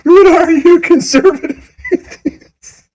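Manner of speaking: high, laughing mock wail; mocking his sob. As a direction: parody his whiny question while laughing. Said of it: "mocking his sobbing line".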